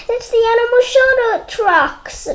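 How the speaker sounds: put-on high bright child voice, lightly done; Betsy. Betsy telling Sam where Blaze went. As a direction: point it out eagerly.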